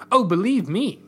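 a touch of indignant huff; huffy. A narrator's reading. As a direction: huff it with some exasperation. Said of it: "Macy huffed".

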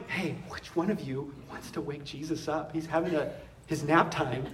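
very soft, tentative imitation; mock hushed hesitation. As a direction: pretend you dare not wake a sleeper. Mock timid disciples beside sleeping Jesus.

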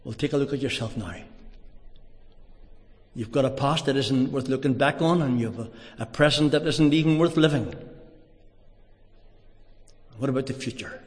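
a hushed, searching question; other inner voice. Retold or imitated. The other voice asks him quietly about his life, soft. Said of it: "this other voice said to me".

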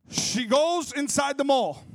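"goes" slowly stretched and loud, like a preacher; drawn-out preacher boom. Retold or imitated. Woman shopping drawn out, loud.

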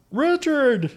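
high, loud call of a name; excited sister calling out; excited shout. Retold or imitated. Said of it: "Probably didn't say it like that, but it sounded like it".

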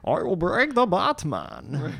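high, slow Connery-style Bane voice; drawn-out movie villain impression; Sean Connery Bane. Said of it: "that was Bane if he was played by Sean Connery".

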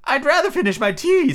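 high, fretful Hatter; nervous Hatter voice. Acted. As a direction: plead it high and thin.

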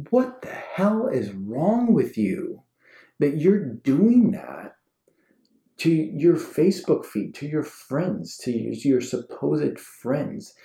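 high, slow, incredulous scolding; exasperated rant. Acted. Telling off someone who posts to supposed friends, exasperated. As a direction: draw out each phrase in exasperation.

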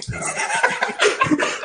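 airy high laugh; airy giggle; breathy laugh. Real, not acted. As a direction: laugh half under the breath. Improv player breaks up.

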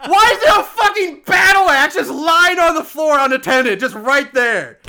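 loud, high, outraged disbelief; exasperated rant. Real, not acted. Co-host baffled by an axe lying unattended, exasperated rant. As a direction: belt out the complaint, stretching the last words.

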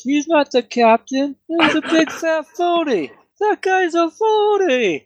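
dopey guard voice. Acted. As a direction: call it down from the wall like a dim guard. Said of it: "Donkey Brain goes".

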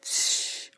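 七 with a strong puff of air, voiceless; 七 breathed out hard, no voice; aspirated qi. Shown as a demonstration. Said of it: "把手掌放到嘴边".

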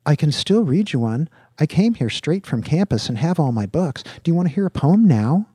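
lightly voiced student, keen to perform; keen young poet angling for an audience; eager young student. Voices Virgil offering a poem.

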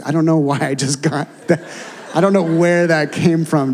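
laughing in surprise at himself; real laugh through the words. Real, not acted. Laughs at his own words.

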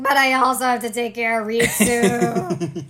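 loud mock outburst, laughing after; exasperated complaint. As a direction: cry it out in hammed-up exasperation. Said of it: "She's like".

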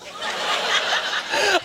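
a high breathy giggle; laughing at the daughter's worry; high airy laugh. Real, not acted.

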